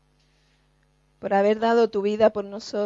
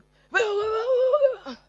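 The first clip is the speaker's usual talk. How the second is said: very high wailing cry for help; mock drowning cry; 12 semitones higher than usual. Preacher acting the drowning sinner crying out. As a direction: wail like you're going under.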